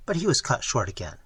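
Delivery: ordinary delivery, nothing marked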